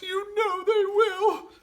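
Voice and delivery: high, slow weeping; crying to her husband; sobbing and slow; tearful. Acted. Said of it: "sobbed Missus Fox".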